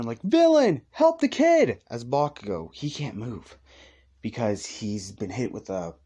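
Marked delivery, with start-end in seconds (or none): 0.2-1.8 much higher, louder panicked yell; crowd screaming. Retold or imitated. Bystanders scream at the villain, much higher and louder. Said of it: "everybody's screaming".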